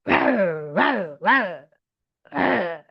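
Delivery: playful mock roars, falling away; tiger roar. Teacher giving a child a tiger clue, playful roar. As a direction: imitate a tiger with repeated growly roars. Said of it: "What animal is that?".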